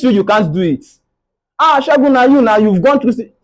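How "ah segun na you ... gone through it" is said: higher, a touch louder, cheering him on; mock encouraging friend. Friends reassuring Segun, lightly voiced cheerful encouragement. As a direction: play the friend who says he's done it before. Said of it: "People will tell you".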